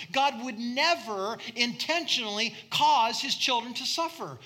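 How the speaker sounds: high, loud fervent preaching; preaching at full force. Acted. Preacher warning the church about bad theology, loud and high. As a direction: drive the point home with fire.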